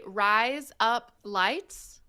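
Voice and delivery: "Rise up lights" very slowly, words apart. Shown as a demonstration. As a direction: spell out "Rise up lights" for a learner. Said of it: "if you say".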